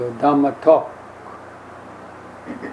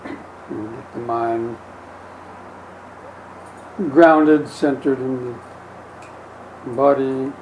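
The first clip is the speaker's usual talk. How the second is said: slow, unhurried meditation guidance with long pauses; guided meditation; 3 dB quieter, slower than usual. Acted. Teacher settling the group before the Dhamma talk, calm guided meditation. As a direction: ease the listeners into settling, gently. Leads a short breathing meditation.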